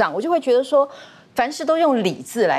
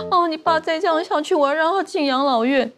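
mother's voice; 8 semitones higher than usual. Retold or imitated. 母亲说要送养老院.

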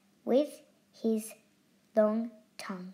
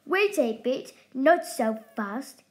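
louder, bristling echidna; 3 semitones higher, 7 dB louder than usual. Acted. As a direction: snap the order out sharply. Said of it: "he bristled".

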